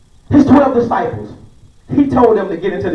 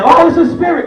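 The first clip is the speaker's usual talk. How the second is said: frightened cry; 7 semitones higher than usual. Retold or imitated. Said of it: "y'all crying out like if as y'all see an evil spirit".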